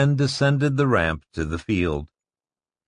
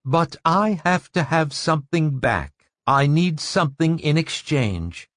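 higher, hard and thin woman's voice; 7 semitones higher than usual. Acted. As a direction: brittle as glass. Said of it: "Her voice was hard and thin".

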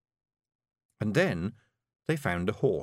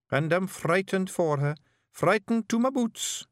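put-on high boyish Scots, thinking hard; young Scottish pictsie bard; 9 semitones higher than usual. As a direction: ponder it like a nervous young bard. Billy explains fear.

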